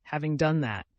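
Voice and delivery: usual speaking voice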